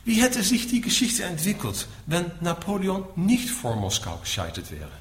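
normal talking voice

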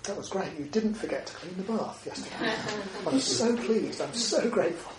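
high, bright-eyed mock gratitude with a laugh; hammed-up delight at a clean bath; gushing praise. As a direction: overdo the thanks, laughing as you go.

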